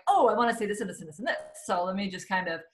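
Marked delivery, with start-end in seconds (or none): 0.1-1.4 rapid rush of excited ideas; quoting her own racing mind, tumbling fast; racing thoughts. Retold or imitated. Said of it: "now my mind is going".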